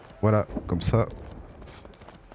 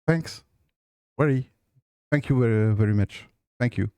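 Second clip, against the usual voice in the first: English spoken with a strong French accent; French speaker talking English; slower than usual. Real, not acted. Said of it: "j'ai un accent anglais très, très pourri".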